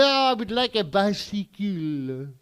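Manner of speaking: put-on slow, thick French-accented English; heavy French accent, loud and slow. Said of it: "You have a weird accent".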